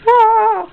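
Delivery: short high yelp of frustration; sharp high cry; frustrated shout. Real, not acted. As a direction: yelp at the lagging computer. Computer lagging, sudden outburst.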